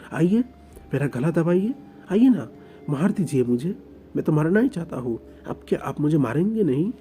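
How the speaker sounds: taunting dare. Acted. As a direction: goad him to strike you. Oing dares Lanjan to kill him.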